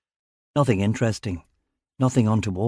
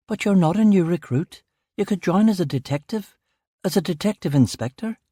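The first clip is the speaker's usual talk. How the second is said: higher, pleading wife's voice; Beth's voice; 7 semitones higher than usual. Acted. Wife urging husband to join the Met, pleading. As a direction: gently press her husband with a lifted voice.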